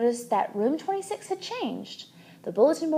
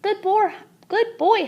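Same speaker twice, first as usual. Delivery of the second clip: higher, louder doting praise; fond praise; 10 semitones higher, 4 dB louder than usual. A narrator's reading. Mother praising the hamster, high and doting. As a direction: dote on a pet.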